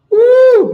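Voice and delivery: high loud whoop of joy; loud excited woo; celebratory whoop. Real, not acted. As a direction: cheer like you just won. Finished marking, celebrating.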